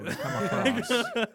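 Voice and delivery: loud, high, drawn-out laughing words. Real, not acted. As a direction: talk through a real laugh. Joking about lip-reading swearing.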